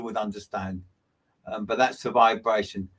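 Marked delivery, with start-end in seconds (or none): none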